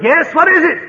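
high shouted reply; sergeant's shout. Retold or imitated. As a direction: shout back across the distance. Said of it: "And the old Sarge shouted".

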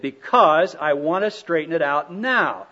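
insist, annoyed, on straightening it out now; irritated. Retold or imitated. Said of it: "Well, don't get angry".

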